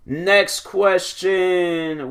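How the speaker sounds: very slow, stretched announcement; slow host-style segment intro; drawn-out announcer. Acted. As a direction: stretch out the words like a show host.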